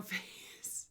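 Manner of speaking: whispered, breaking into a giggle; hushed amused reading with a giggle; giggling whisper. Real, not acted. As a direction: read it, stifling a laugh. Giggling at newspaper headline.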